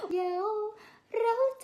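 excited squeal. Real, not acted. Squeals while reading a gift.

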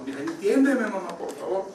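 imitated whiny-child plea, no real tears; retold crying child. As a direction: bargain like a scared child, played for laughs. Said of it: "llora y llora el pobrecito".

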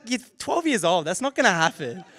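loud, exasperated scolding; cracking it. Retold or imitated. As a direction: scold them in retold disbelief. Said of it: "Uh, I cracked it".

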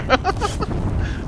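breathless squealing giggle; a silent, high wheeze of a laugh; high wheezing laugh. Real, not acted. Gamer cracking up at the hit, breathless high giggle.

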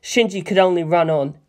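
ordinary delivery

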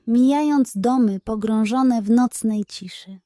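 usual speaking voice

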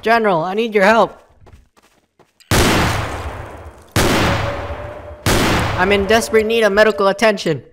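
earnest militiaman pleading for help; Preston Garvey. Acted. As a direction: appeal to your general for aid. Plays Preston Garvey in game.

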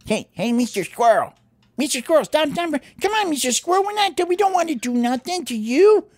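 loud, high call across the yard. Acted. Calling Mister Squirrel to stop running, high and loud. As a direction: shout after the squirrel to stop.

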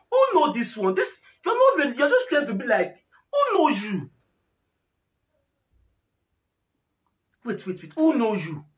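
repeated jeering who knows you; scornful jeer who knows you. Real, not acted. Repeated mocking taunt.

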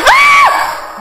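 sudden terrified scream at a jump scare; real startled shriek, very high and loud. Real, not acted. (grito de susto real)

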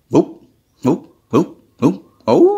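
short gruff bark sounds buk buk; imitated dog barks. Hulk showing off by barking like a dog. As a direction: bark like a dog on the count of three. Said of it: "Aku bisa menirukan suara anjing".

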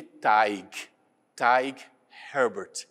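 "Taig" as one syllable, like "tie" closed by a g; correct native pronunciation. Shown as a demonstration. Priest giving the interviewer his real name, "Taig Herbert" pronounced natively. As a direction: say "Taig Herbert" the Irish way, name by name, with short pauses. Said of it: "qual que é a pronúncia correta".

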